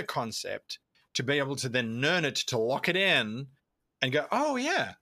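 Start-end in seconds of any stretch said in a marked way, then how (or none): 4.3-4.9 higher-pitched "aha" moment; sudden realisation. Acted. Player realising they already do it, sudden realisation. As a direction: voice lifts as it clicks.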